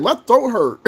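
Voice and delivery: whiny mom excuse; mama's excuse. Retold or imitated. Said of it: "Be like".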